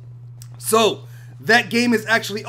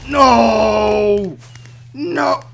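anguished no; 5 dB louder than usual. Real, not acted. Losing fight in game.

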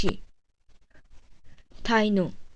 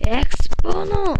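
attack call; 7 semitones higher, 4 dB louder, slower than usual. Acted.

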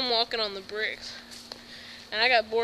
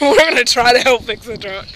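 laughing; 10 dB louder than usual. Real, not acted. Laughing correction.

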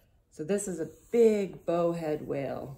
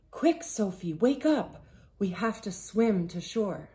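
loud, higher urgent wake-up call; raised, pressing storybook voice; 4 semitones higher, 6 dB louder than usual. A narrator's reading. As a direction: rouse a sleeping friend in a hurry. Bear urgently wakes friend on ice.

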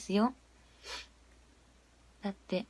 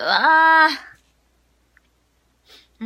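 long, loud, higher dismayed wail; drawn-out dismayed wail; 4 semitones higher, 8 dB louder, much slower than usual. Real, not acted. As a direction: let out a long embarrassed groan. Embarrassed at own voice.

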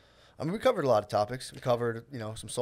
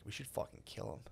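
mock conspiratorial; 16 dB quieter than usual. Joker plotting with another guy, hushed mock conspiratorial. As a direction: drop it to a near-whisper, as if plotting.

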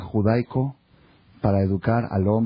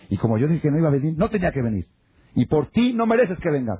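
higher-pitched, emphatic imitated scolding; stern rebuff; 6 semitones higher than usual. Brother telling his sister she doesn't deserve him, stern. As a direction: snub them firmly, pitch climbing.

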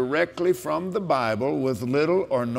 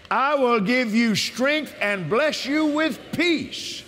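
preaching at full force; 8 semitones higher, slower than usual. Acted. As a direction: shout the promise like from the pulpit.

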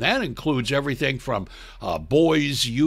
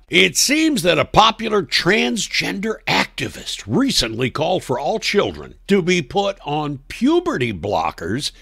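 ordinary talk

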